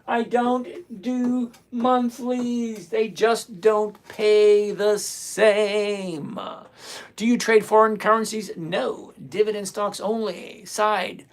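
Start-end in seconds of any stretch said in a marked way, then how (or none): 0.0-6.4 very slow, heavily stretched words; exasperated spelling-out. Real, not acted. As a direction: draw out every word as if to someone not listening. Spells out rule already told.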